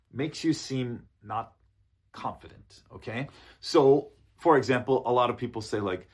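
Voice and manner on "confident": ordinary talk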